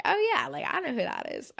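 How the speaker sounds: a lightly mimed lightbulb moment, half laughing at herself; sudden recognition. Retold or imitated. Recalling the reveal to viewers, mock surprised realization. Realizing it's [name].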